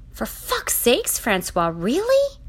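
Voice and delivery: high, drawn-out exasperated scolding; slow, incredulous reproach; appalled exasperation. A narrator's reading. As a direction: scold in high-pitched disbelief. Appalled Charlie scolds him.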